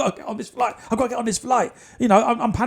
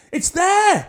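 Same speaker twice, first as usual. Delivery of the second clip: high loud joyful scream; 11 semitones higher, 6 dB louder than usual. Retold or imitated. Desk clerk screaming when the ticket appears. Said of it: "she screams".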